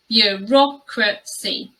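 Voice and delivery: "bureaucracy" slowly broken up, byuh-ROK-ruh-see; slow "bureaucracy" with the b-y blend; syllable-by-syllable model. Shown as a demonstration. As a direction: sound out "bureaucracy" slowly for a learner. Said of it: "the blend of the b, y sound".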